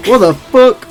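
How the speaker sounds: loud, high startled yell; startled outburst. Real, not acted. Streamer loses sight of the boss mid-fight, startled shout. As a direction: blurt it out loud and high in shock.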